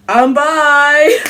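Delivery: bursting out, loud and high; loud announcement. Real, not acted. Telling viewers she's bi, a loud proud outburst. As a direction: shout it proudly.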